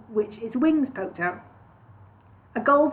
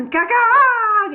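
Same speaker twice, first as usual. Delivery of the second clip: high, loud bird cry; sudden loud caw; 13 semitones higher, 9 dB louder than usual. Acted. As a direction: burst out with a sharp caw. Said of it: "it said loud and sudden".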